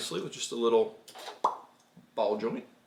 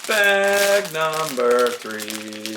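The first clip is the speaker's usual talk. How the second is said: 1 announcer; 3 semitones higher, 6 dB louder, much slower than usual. Acted. Dad announces next bag.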